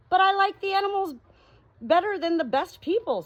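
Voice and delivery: high, loud voiced doctor; the doctor's voice. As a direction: answer his sister brightly, pleading. Doctor answers sister, voiced.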